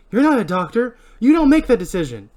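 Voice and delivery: heated, indignant retort; angry argument. Acted. Julie argues with Barry.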